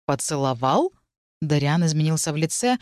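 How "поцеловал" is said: low, hoarse, disbelieving question; darkened, shocked query; hoarsened voice. A narrator's reading. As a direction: echo it low, rising at the end. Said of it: "его голос заметно охрип".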